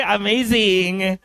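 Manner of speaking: very slow, stretched exclamation; drawn-out catchphrase. Pretend. Seminar speaker hyping the audience, drawn-out catchphrase. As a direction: linger playfully on every syllable.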